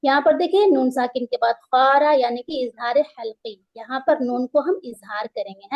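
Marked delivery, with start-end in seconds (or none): none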